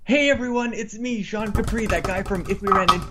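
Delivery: upbeat podcast host opening; show intro voice. Acted. Intro read retake.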